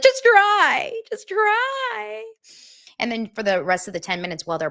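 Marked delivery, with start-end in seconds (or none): 0.0-2.2 much higher and louder, begging him; high pleading girlfriend voice. Retold or imitated. Recapping a reality show, voicing the bride begging him. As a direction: squeal it out like a pleading fiancée. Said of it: "And she's like".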